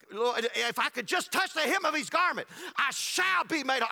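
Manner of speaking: rising, pleading cry of faith. Retold or imitated. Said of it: "she got louder and louder and louder".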